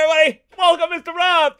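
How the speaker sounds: ordinary talk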